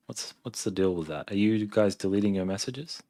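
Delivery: ordinary talk, nothing marked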